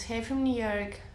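usual speaking voice

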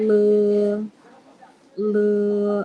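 "เลอ" held long and level; demonstrating pinyin sound. Shown as a demonstration. Teacher models letter L for pupils, sustained "เลอ". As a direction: draw out "เลอ" as one syllable. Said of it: "ตัวนี้คือตัว L นะ".

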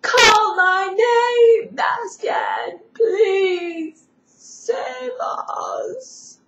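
high, slow, tearful call, half sung; pleading cry. Acted. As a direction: beg him to save them, crying out. Empress pleads to Bastian.

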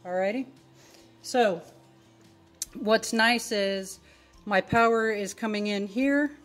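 ordinary talk

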